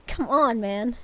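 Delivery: ordinary delivery, nothing marked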